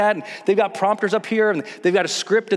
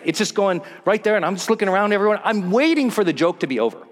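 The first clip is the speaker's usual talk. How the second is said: ordinary talk, nothing marked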